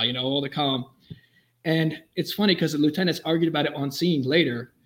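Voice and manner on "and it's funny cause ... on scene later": ordinary delivery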